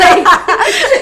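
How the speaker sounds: loud, high burst of laughter; giggling. Real, not acted. Host giggly after watching ten edits, loud high laugh.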